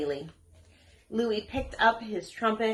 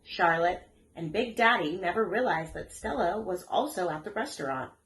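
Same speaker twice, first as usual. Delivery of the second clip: plain reading voice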